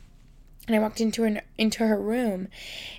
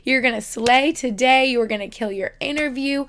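mirror pep talk; 3 semitones higher, 4 dB louder than usual. Acted. Speaker to her own reflection before an interview, playful hyped encouragement. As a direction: hype yourself up like your own sassy cheerleader. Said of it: "look in the mirror and be like".

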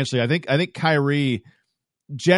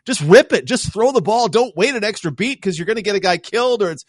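frustrated fan yell; 7 semitones higher than usual. Retold or imitated. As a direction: yell at the quarterback to throw. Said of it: "you go".

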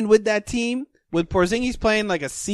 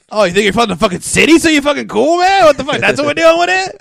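louder, higher hammed-up indignation; mock outrage; 7 semitones higher, 4 dB louder, faster than usual. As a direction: play up fake offense at a city snob. Mock argument about towns.

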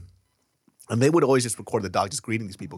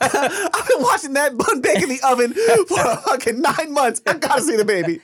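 playful neighbor voice; 13 semitones higher than usual. Acted. Friend playing neighbor, laughing.

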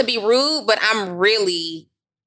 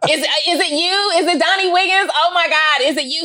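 excited fan; 7 semitones higher than usual. Retold or imitated. Hospital clerk gushing over a podcast host, high and excited. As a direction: gush like a starstruck fan.

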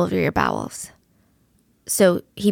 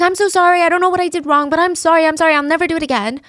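desperate pleading; 7 semitones higher, faster than usual. Retold or imitated. Teen locked in a room begs his captors, frantic and high. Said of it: "he's screaming, like, trying to get their sympathy".

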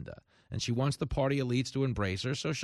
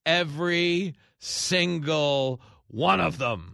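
slow, louder sarcastic retort; sarcastic answer; 4 semitones higher, 5 dB louder, much slower than usual. Acted. Host answering the mocked question, slow sarcastic retort. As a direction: drag it out dismissively.

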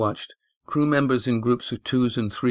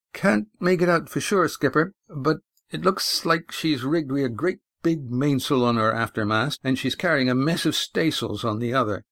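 higher-pitched sailor reporting to his skipper; sailor's character voice, raised at first; crewman's lookout report; 4 semitones higher than usual. Acted. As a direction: report the rig, starting high and settling.

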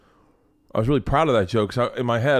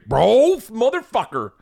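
loud exclaim; 12 semitones higher, 5 dB louder, slower than usual. Real, not acted. Exclaiming after the singing.

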